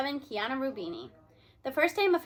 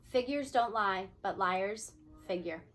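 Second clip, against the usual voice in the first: slow saying; 4 semitones lower, slower than usual. Acted. Quoting an old saying.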